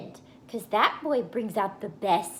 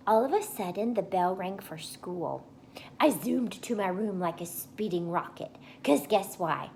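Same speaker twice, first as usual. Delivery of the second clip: ordinary delivery, nothing marked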